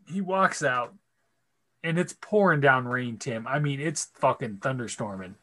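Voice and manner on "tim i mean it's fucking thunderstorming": ordinary delivery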